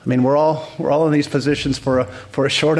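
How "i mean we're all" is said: normal talking voice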